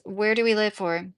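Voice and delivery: ordinary talk, nothing marked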